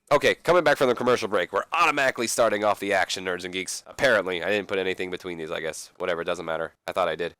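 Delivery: ordinary talk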